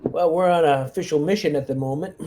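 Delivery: ordinary delivery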